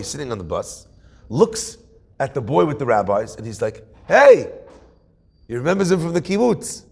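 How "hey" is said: loud, high shout of recognition; calling out. Retold or imitated. Boy hailing someone he knows on the bus, calling out. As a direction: call out sharply higher to get attention.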